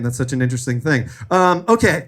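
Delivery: ordinary talk, nothing marked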